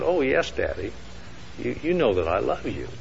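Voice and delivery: put-on hushed, earnest child's reply; soft little girl. Little girl sweetly assures her daddy, softly. Daughter answers father tenderly.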